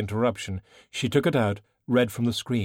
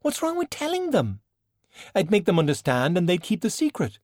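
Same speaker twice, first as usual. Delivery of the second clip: much higher, urgent question; pleading protest; 9 semitones higher than usual. Acted. Valkyrie pleads with China to tell her parents, urgent. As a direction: demand an answer.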